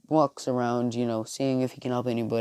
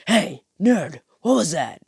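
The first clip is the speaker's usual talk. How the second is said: higher, louder challenging call; a rival squaring up, higher; mock Bakugo; 5 semitones higher, 4 dB louder than usual. Bakugo confronting Deku as he leaves, loud challenge. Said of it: "Bakugo walks up and says".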